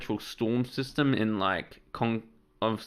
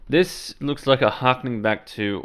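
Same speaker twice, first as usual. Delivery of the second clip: ordinary delivery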